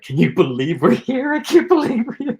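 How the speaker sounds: high, loud giddy squeal; acting out two starstruck, giggly actors; giggly excitement. Two actors waiting for Morgan Freeman, giggly and giddy. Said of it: "me and Chris are kinda giggling".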